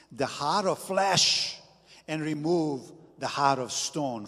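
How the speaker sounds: preaching emphasis. Acted. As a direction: lean into flesh.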